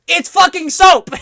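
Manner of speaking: high, loud and slow; loud mock yell. Quoting Hassan's exasperated rant, loud. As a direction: belt out the punchline. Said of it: "Hassan's take".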